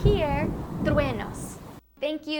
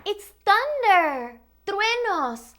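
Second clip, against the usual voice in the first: excited; 8 semitones higher, 7 dB louder, slower than usual. Pretend. Excitedly naming thunder to children.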